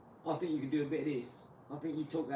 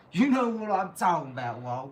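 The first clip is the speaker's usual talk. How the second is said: loud taunt; 4 semitones higher, 11 dB louder than usual. Acted. Taunting a rival on stream.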